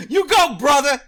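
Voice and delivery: root for him like a fan; cheering shout. Real, not acted. Cheering the guest on.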